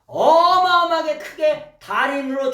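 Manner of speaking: high, loud preacher's cry; loud, high cry from the pulpit; preaching shout. Real, not acted. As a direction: belt it out like calling to the crowd.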